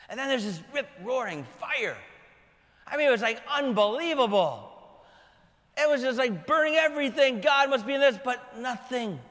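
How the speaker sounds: normal talking voice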